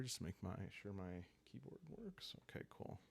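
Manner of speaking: whispered under the breath while checking the keyboard; muttered aside. Real, not acted. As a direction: drop to a whisper, talking to yourself.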